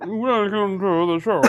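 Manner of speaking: slow, lightly put-on mock announcer; a hint of a drunk pitchman; mocking impression. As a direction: tease him with a hammy intro. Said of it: "me making fun of him".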